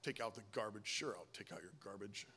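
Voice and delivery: very low, quiet resentful grumble; grumbling under breath. Acted. Husband doing chores he resents, grumbling under his breath. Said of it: "grumbling under my breath".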